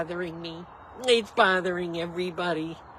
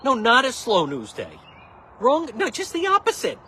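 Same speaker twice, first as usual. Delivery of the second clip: high, loud indignant retort; indignant no, excuse me; annoyed rebuttal; 8 semitones higher, 5 dB louder than usual. Real, not acted. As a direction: push back, offended. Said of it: "That was annoying though".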